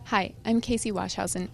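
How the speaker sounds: ordinary talk, nothing marked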